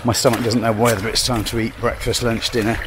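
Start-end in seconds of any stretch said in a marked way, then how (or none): none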